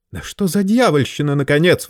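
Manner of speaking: high-pitched indignant protest; a high, offended outcry; indignant outburst. Acted. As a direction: bristle with outrage. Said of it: "возмутился Варбек".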